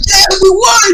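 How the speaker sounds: high, loud, jubilant yell; happy cheer. Retold or imitated. Player retelling a happy reaction to winning a vote, jubilant cheer. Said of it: "so happy".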